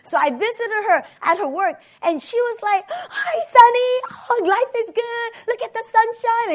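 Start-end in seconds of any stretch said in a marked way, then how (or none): 2.9-6.5 high, sing-out cheery greeting; hammed-up bubbly friend voice, pitched way up; gushing happy. Happy friend greeting her at work, gushing. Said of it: "she's all happy".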